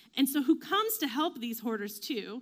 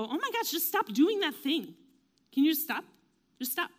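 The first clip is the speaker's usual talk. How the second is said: mock-exasperated plea, higher pitched; 5 semitones higher, 4 dB louder than usual. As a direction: act the onlooker who has had enough. Acts out exasperated onlookers.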